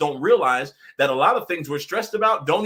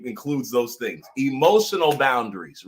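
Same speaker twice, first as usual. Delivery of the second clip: ordinary delivery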